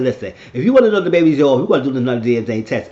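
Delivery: loud, fast, pushy challenge; insistent retort. Retold or imitated. Speaker urging a DNA retest, insistent. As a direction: press the point loudly and quickly.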